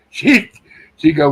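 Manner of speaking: ordinary talk, nothing marked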